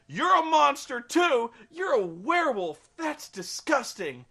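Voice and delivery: high, loud, slowed accusation; mock disgusted scolding. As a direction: spit it out like a shocked girlfriend. Bella scolds werewolf Jacob.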